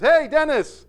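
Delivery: high, loud imitated woman's call. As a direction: call over to a fellow peasant. Woman calls to Dennis.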